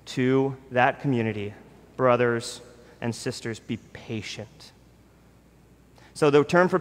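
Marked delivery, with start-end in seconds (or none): 1.9-4.7 soft, fading to a whisper; whispered plea. Acted. James urging his suffering community, whispering gently. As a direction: hush it like a tender secret.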